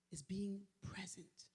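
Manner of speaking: slow, breathy whisper. Real, not acted. Preacher confessing her struggle to the congregation, slow whisper. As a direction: let it fall to a whisper.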